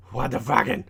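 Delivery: normal talking voice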